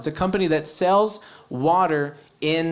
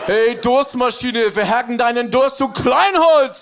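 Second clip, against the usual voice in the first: higher, louder aggressive German ad yell; German ad slogan shout; 4 semitones higher, 4 dB louder than usual. Pretend. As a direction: bark it like a wild German commercial. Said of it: "if a German company tried".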